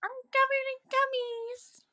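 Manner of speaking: very high squeaky uwu voice; high cutesy baby talk. Acted. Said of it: "uwu bitch voice".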